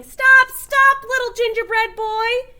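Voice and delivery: high, loud call to stop; shouting. A narrator's reading. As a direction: yell after him to stop.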